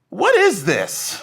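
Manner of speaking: slow, high, puzzled mom voice; mock mom squinting at the painting; drawn-out confused mom; mom's baffled question.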